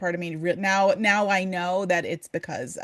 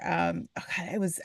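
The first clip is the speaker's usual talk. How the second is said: normal talking voice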